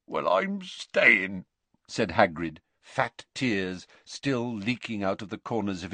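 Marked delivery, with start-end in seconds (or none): 0.1-1.5 slow, choked-up giant; tearful. A narrator's reading. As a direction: say it through tears. Hagrid weeping.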